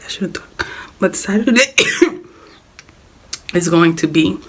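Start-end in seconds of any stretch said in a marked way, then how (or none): 0.0-0.7 whispered to save the voice. Real, not acted. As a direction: drop to a breathy whisper.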